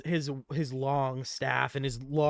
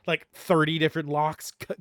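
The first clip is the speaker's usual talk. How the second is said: ordinary talk, nothing marked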